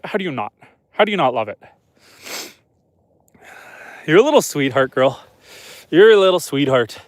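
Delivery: ordinary talk, nothing marked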